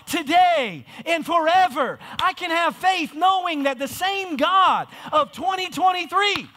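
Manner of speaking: high, loud preaching that builds to a shout; full-force preaching. Acted. Sermon climax at full force.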